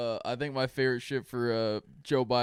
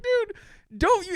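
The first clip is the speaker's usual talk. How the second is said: high-pitched disbelief; 5 dB louder than usual. Retold or imitated. Retold outburst.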